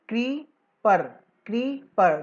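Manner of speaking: "क्रीपर" split into क्री and पर; syllables spelled out. Shown as a demonstration. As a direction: say "क्रीपर" in two clear beats, क्री then पर. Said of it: "दो बार बोल रहे हैं हम क्री और पर".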